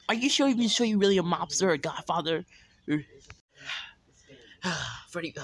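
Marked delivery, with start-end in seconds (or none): 0.1-2.4 high, loud, disbelieving "are you sure"; incredulous challenge. Pretend. Mocking a friend who claims to be a mobster, loud and incredulous. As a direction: push back at him in loud disbelief. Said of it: "I'm like".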